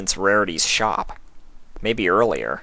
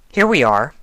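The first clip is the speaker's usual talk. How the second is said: cheerful, a touch higher; brightly; 6 semitones higher, 4 dB louder than usual. A narrator's reading. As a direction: announce it brightly. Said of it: "she said brightly".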